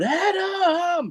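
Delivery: high, drawn-out imitation yell; Roderick Strong. Podcaster mimicking a wrestler calling out mid-match, high stretched yell. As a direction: wail the name out like a wrestler calling his partner. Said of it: "Roderick Strong yelling out".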